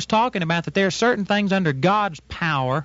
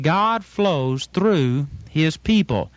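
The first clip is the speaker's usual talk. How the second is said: slow emphatic; much slower than usual. Shown as a demonstration. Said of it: "that's really important that you get that".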